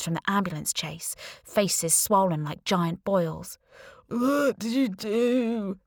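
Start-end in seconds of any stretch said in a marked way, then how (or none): 4.1-5.8 slow, whimpering chorus of severed heads; severed heads reproach the narrator in a slow whimper; ghostly whimper. A narrator's reading. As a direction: whimper it slowly like a pitiful ghost. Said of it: "they whimpered in chorus".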